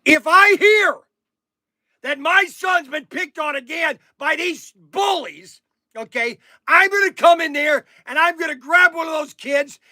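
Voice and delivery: hammed-up outburst at the bullies; mock angry warning. Father rehearsing what he'll tell the bully's dad, mock furious shout. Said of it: "I wanna say".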